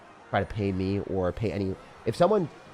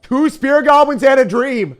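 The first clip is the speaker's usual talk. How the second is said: much higher, louder and slower announcer shout; hype shout; 14 dB louder, slower than usual. Pretend. Streamer hyping his meme deck to chat with a big shout. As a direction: bellow it like a ring announcer.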